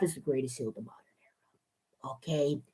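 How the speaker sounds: fast whispered aside. Pretend. Reviewer whispering his favourite claim. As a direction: hiss the secret fast. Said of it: "whispers the claim".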